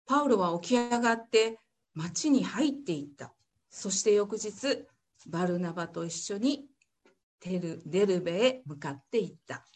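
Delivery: measured Bible reading for the congregation; formal church reading; scripture reading. Acted. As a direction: read the passage clearly and steadily. Reads Acts passage aloud.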